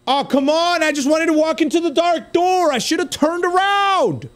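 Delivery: high, wailing complaint; real gamer frustration, pitch way up; exasperated protest. Real, not acted. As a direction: cry out at the unfairness, drawing out the end. Lost the round, protests.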